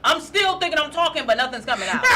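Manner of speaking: ordinary talk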